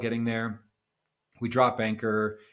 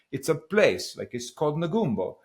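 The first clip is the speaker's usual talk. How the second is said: put-on higher-pitched Italian accent; 6 semitones higher, 3 dB louder than usual. Skipper explaining an unfamiliar spot, imitated Italian accent. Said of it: "stop with the Italian accent".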